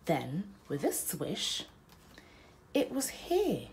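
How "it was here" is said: ordinary delivery, nothing marked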